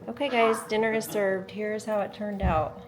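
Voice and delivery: loud bright presenter voice; cheerful announcement. Real, not acted. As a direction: announce dinner proudly to the viewers. Vlogger presenting dinner to camera.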